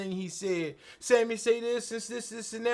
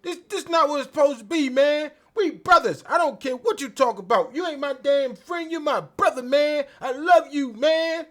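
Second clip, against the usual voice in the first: passionate Sami quote; 5 semitones higher, 5 dB louder than usual. Retold or imitated. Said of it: "and Sammy said".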